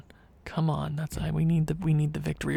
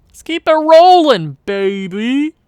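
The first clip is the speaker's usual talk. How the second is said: loud, high hype shout; excited cheer; 8 semitones higher, 10 dB louder, slower than usual. Acted. Gamer cheering a win streak, excited cheer. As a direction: belt it out to hype up the team.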